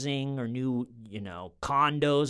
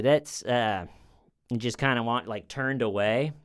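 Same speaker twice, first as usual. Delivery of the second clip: ordinary delivery, nothing marked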